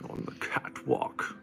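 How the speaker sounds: masked villain voice, high and breathy; Bane voice. Acted. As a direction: growl out the catwalk like Bane. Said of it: "Stop turning into Bane when you do it".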